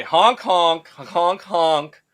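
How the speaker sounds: playful goose honks, chanted in a row; honking. Pretend. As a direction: honk like a goose on cue. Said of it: "gonna turn into honking".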